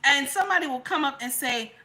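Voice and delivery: ordinary delivery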